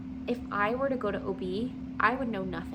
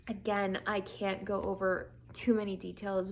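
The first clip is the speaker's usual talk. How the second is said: usual speaking voice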